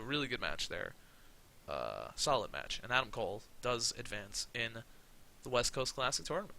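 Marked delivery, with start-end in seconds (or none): none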